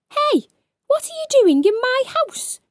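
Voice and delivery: high, loud angry goblin voice. Acted. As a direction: snap at the intruder like a little goblin. Angry goblin confronts intruder.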